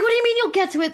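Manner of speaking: high-pitched, exasperated comeback; incredulous. Retold or imitated. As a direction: fire it back in mock disbelief. Retells arguing with husband and son.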